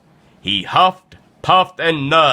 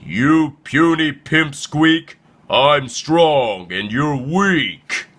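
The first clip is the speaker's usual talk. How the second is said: slow, taunting bully voice; boastful, drawn-out sneer at a weakling; bullying llama; 3 semitones higher, slower than usual. Acted.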